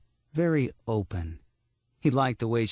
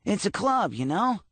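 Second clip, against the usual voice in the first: high, scratchy cartoon voice; scratchy like a cartoon character; 10 semitones higher than usual. A narrator's reading. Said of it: "strange, scratchy voice like a cartoon character".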